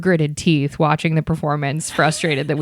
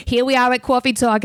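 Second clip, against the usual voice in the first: mock New York accent; 6 semitones higher than usual. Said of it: "very stereotypical New York accent".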